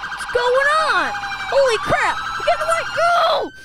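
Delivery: high, loud excited shouting. Real, not acted. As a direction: yell it mid-chase. Chase frenzy.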